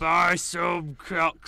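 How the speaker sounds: slow, drawn-out shouted ad; shouting. Pretend. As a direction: bellow it like a market seller. Shouted mock advert.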